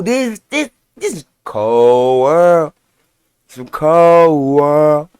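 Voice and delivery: drawn-out, slow stunned words; slow disbelief. Real, not acted. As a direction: stretch each word in disbelief. Shocked by the crime.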